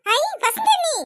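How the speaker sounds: high, thrilled cry of welcome; sudden excited welcome; delighted greeting. Acted. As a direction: squeal at the guest arriving.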